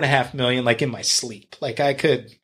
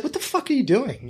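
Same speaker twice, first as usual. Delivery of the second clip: exasperated blunt coach; 10 semitones higher than usual. Retold or imitated. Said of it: "and she doesn't talk that way".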